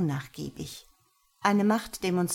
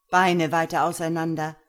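barked order, much louder; harsh command; 8 dB louder than usual. Acted. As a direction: order her sharply and loud. Master commands Lena during punishment. (Herr befiehlt Lena scharf und laut)

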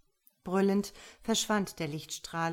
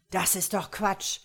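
raised, dismissive exclamation; mildly raised voice; called out; 4 dB louder than usual. A narrator's reading. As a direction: call it out in protest. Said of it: "rief Dwyer".

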